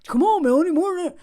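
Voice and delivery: loud, high pushy demand; demanding shout. Retold or imitated. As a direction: yell it like a slave driver. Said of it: "some people may be like".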